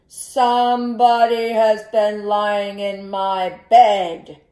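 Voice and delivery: loud, slow booming bear; great big papa bear voice. A narrator's reading. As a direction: boom it out slowly as a huge bear. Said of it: "he said in his great big".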